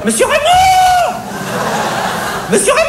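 cri suraigu, étiré, pour appeler le chef; hurlement de vendeuse. Acted. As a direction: hurler le nom à tue-tête, très haut perché. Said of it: "hurla-t-elle". (appel hurlé et strident)

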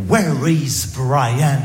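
hugely drawn-out parody of a lesson question; slow classroom English. Retold or imitated. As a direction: stretch every word like a language drill.

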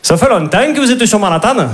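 put-on high, singsong Midi accent in a New York romcom; sunny accent du Midi; southern French accent. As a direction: play a romcom lead with a thick Marseille accent. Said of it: "avec l'accent du Midi".

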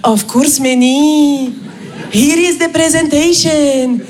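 put-on cheerful Indian-accented English, drawn out; slow, singsong Indian English; Raj. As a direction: imitate an obliging colleague with an Indian accent. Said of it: "Raj me répond dans les trente secondes".

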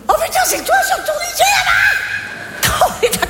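high, laughing disbelief; laughing surprise. Real, not acted. Friend spotted on the roundabout, laughing surprise. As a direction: cry out in amused shock.

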